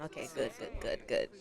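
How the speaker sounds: breathy whisper, barely voiced; hushed good good good; whispered encouragement. Real, not acted. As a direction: whisper it to yourself, willing it to work. Quietly cheering on her sim.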